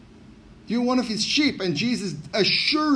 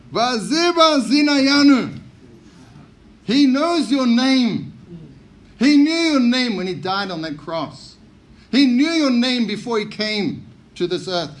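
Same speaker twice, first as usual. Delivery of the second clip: loud, raised preaching voice; forceful preaching; 5 semitones higher, 5 dB louder than usual. Real, not acted. Preacher assuring congregation Jesus knows their names, forceful preaching. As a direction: drive each declaration home to the congregation.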